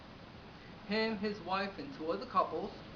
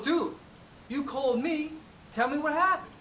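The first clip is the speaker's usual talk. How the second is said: louder, higher exasperated push; impatient demand; 7 semitones higher, 6 dB louder than usual. Acted. Owner to stalling client who called him, exasperated. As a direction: press the caller, voice climbing.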